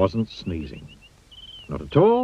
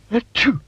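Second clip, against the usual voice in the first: high sneeze; 13 semitones higher, 3 dB louder than usual. Acted.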